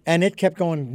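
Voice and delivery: usual speaking voice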